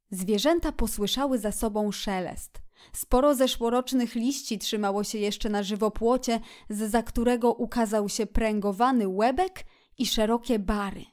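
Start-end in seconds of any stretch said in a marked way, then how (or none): none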